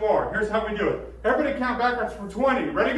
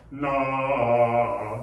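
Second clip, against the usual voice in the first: much lower sung no; low bass no; 8 semitones lower, 3 dB louder than usual. Shown as a demonstration. Choir teacher showing where basses would sing. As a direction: sink into the bass. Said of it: "I would have gone".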